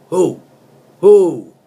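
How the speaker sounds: shouted who, rising in force; hammed-up yell of the song's hook; mock shout. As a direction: bellow the hook like a party cry.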